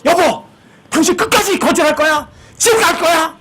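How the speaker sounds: high-pitched wife butting in; 끼어드는 아내. Acted. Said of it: "부인이 끼어드는 거예요". (남편에게 따지며 끼어드는 아내의 높은 목소리)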